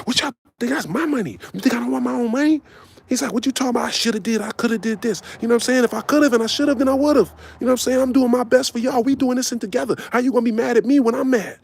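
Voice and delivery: high, fast imitated outburst; Brick flipping. Calm pimp suddenly snapping at the girls, high and fast. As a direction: retell his blow-up pitched high and quick. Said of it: "he just flipped".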